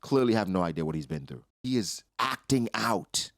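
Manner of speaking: building from plain to a high, loud scolding; pissed off, telling a father what his kid needs; lecturing Harold. Retold or imitated. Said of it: "'cause I'm like".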